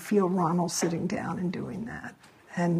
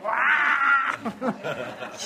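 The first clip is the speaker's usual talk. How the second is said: high, playful mock yell at an agent; mock shout; 6 semitones higher than usual. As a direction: let out a comic, high-pitched "aah" of outrage. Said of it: "you shout at the agent".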